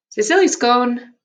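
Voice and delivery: ordinary talk, nothing marked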